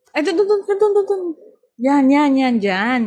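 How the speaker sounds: loud, quick pointing calls; calling out. Real, not acted. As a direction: call out to them, loudly. Calling out directions loudly.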